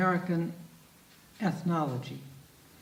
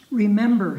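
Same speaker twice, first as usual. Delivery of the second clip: poem reading; 3 semitones higher, 10 dB louder than usual. Acted. Reading Jeffers poem aloud.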